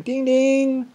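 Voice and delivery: high mock bell ding; imitated ship bell. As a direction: ring it out like a ship's telegraph bell. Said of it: "one guy's up there on the bridge going".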